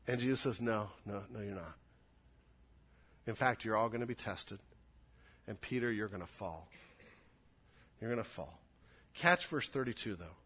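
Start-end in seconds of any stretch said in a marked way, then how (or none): 0.6-8.6 gentle correction. Acted. Jesus gently correcting Peter.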